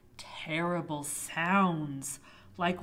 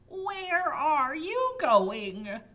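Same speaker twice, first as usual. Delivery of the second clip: high witch voice; 7 semitones higher, 6 dB louder, much slower than usual. Acted. Witch appears asking.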